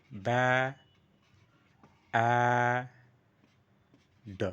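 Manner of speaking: ব্যা, আ, ড in separate held pieces, long vowel; stretched, sounded out. Shown as a demonstration. Teacher breaking the word down, drawn-out ব্যা, আ, ড. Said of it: "এটা stretch করছি".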